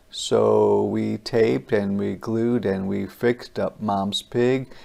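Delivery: ordinary delivery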